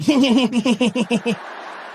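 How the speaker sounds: weird staccato chuckle; long rapid mock chuckle; George Bush. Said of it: "that weird chuckly thing".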